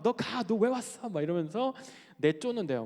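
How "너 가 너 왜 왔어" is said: imitating a drill sergeant's scolding, pitched up; mock cursing. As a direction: scold a recruit in their ear. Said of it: "막 귀에다 대고 막 욕하고". (교관이 다그치듯 높은 목소리로)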